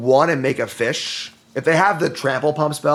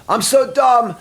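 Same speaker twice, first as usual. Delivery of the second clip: self-scolding outburst; 11 semitones higher, 5 dB louder, slower than usual. Real, not acted. Realizing misplay mid game.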